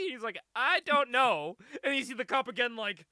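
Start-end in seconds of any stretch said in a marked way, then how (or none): 0.6-1.6 slow, loud, higher-pitched denial; emphatic cluelessness. Retold or imitated. Savage Dragon under rapid questioning, slow exaggerated cluelessness. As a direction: stretch each word out emphatically. Exaggerated answer to cop's grilling.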